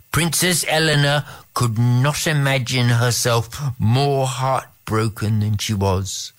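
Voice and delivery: usual speaking voice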